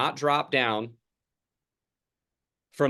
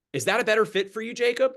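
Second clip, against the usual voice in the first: "is that a better fit for you" asked higher and quicker, rising to a friendly "Jacob"; friendly feeler question; 9 semitones higher than usual. Shown as a demonstration. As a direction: ask it brightly and quickly, as if checking in with a friend. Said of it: "And then I'll say to them".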